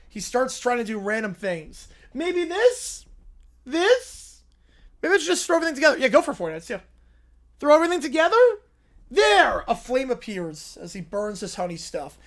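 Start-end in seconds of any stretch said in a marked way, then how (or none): 2.1-9.6 high, surprised little bear voice; little bear jolted awake; high cartoon bear. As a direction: squeak it out like a startled cub. Bear tries things.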